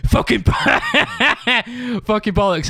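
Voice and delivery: high-pitched hammed-up yelling, breaking into laughter; mock angry shout. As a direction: imitate an angry bandmate yelling, then crack up. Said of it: "shouts like".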